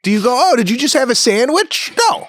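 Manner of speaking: high, loud mock outrage; indignant. Husband mocking the hungry question, loud and high. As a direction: play up the indignation, pitched high. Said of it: "mock outrage".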